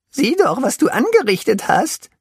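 hoher, lauter Vorwurf; vorwurfsvoll. A narrator's reading. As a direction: zeig ihm empört den Schaden. Pinsel scolds the elephant. (Pinsel tadelt den Elefanten, laut und hoch)

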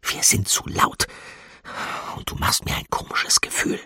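hushed warning whisper; breath-only secretive voice; rough whisper. A narrator's reading. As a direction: whisper it roughly, afraid of being overheard. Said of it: "flüsterte sie rau".

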